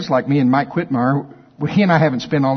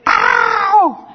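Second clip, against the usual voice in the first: high hammed-up yelp of pain; preacher voicing the flesh with a high yelp; mock cry of pain; 4 dB louder than usual. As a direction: yelp like a child who got hurt. Flesh crying out on the cross.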